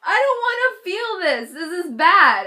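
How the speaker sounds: high, loud half-laughing whine; scared. Retold or imitated. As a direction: whimper it like a scared kid about the needle. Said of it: "and I was scared".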